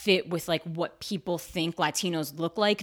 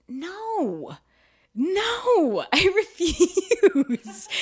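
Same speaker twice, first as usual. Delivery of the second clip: high, drawn-out mock-defiant refusal; loud comic protest, stretching the last word; stubborn refusal; 12 semitones higher, 6 dB louder, much slower than usual. Actor asked to change her name, stubborn refusal.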